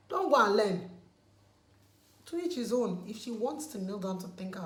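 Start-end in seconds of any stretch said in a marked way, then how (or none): 0.1-3.0 sudden loud rebuke; loud scolding. Real, not acted. Scolding critics who judge the kneeling wife, loud. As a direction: bark it at the critics.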